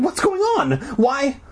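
very high, alarmed questions; crying out. A narrator's reading. Girl asks her friend what's happening, high alarmed cry. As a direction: demand answers in a high cry. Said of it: "I cried".

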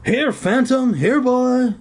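high coaxing call to a dog. A narrator's reading. Zeke coaxing a dog in the dark, high call. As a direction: coax the dog out, voice pitched high. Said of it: "Zeke called softly, as if calling a dog".

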